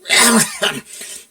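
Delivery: a sudden loud forced cough. Acted. Buck cutting off his own slip with a loud cough. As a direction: cough hard to cut yourself off. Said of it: "he coughed loudly".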